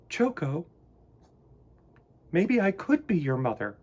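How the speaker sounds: high, kindly mother bear voice; gentle mother bear. Acted. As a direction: speak as a caring mama bear offering to adopt. Missus Bear character voice.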